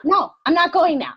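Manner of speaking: mock exasperated retort. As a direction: play up the stubborn refusal with a laugh. Mother's exasperated retort, retold.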